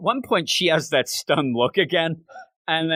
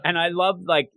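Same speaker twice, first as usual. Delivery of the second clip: usual speaking voice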